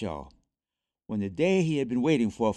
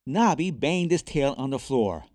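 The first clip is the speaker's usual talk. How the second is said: ordinary delivery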